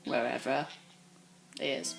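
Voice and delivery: hushed, scared hiding talk; quiet hiding voice. Real, not acted. Hiding from the killer.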